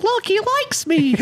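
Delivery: put-on very high, slow little-kid voice; Sarah. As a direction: imitate a delighted six-year-old, squeaky and drawn out. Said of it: "little six-year-old Sarah's like".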